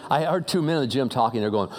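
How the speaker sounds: usual speaking voice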